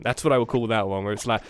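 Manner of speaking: ordinary delivery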